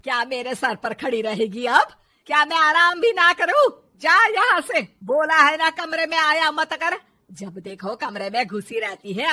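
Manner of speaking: loud, hectoring tirade; angry scolding. Acted. Mother-in-law throwing the daughter-in-law out of her room, angry. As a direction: berate her at full volume.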